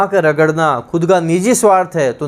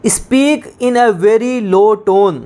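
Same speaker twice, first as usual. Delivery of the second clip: slow dictation; 3 semitones higher, much slower than usual. Shown as a demonstration. Teacher dictating a definition to students, very slow. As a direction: give each word its own weight, slowly. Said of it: "dictating definition".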